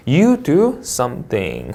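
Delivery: slow, falling close on "something"; falling intonation. Shown as a demonstration. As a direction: bring "something" down to end the sentence. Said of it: "하고 내려읽는 거". ("something" 끝을 내려 읽기)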